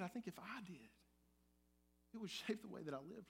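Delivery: soft unvoiced whisper. Real, not acted. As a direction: confide it under your breath. Confessional aside after question.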